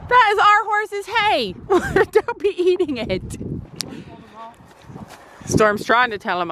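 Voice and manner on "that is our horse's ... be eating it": high teasing scold of the horse; mock scolding. Real, not acted.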